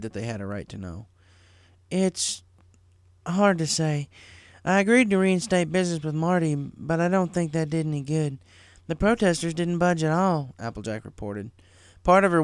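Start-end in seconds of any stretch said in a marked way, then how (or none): 1.9-10.5 put-on a little higher, gently firm older sister; a hint of a caring big sister; lightly voiced big sister. A narrator's reading. Big sister settling little sister's fight, lightly voiced.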